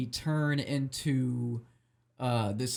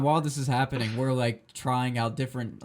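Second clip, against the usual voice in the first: usual speaking voice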